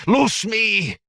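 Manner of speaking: high furious howl, loud; howled demand. Acted. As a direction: roar it out in rage. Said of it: "the tribesman howled".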